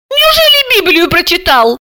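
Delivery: very high, incredulous voice; astonished. A narrator's reading. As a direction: ask it squeakily, amazed. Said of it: "поразилась Алиса".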